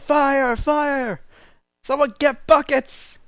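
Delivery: high-pitched, loud cries for help; screamed alarm. Acted. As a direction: raise the alarm like a panicking camp crowd. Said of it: "you start hearing screams of".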